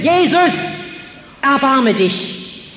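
beggar's desperate call, stretched out slowly; crying out with all his might. Retold or imitated. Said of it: "aus Leibeskräften zu brüllen".

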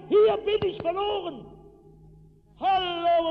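high, loud cry for help; desperate shout. Retold or imitated. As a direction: scream to be found. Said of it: "Ich konnte noch schreien".

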